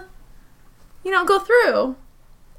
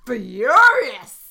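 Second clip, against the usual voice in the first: drawn-out, forceful growl of fury; acted fury; 3 semitones lower, 5 dB louder than usual. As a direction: stretch the word out with hammed-up rage. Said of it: "and I am".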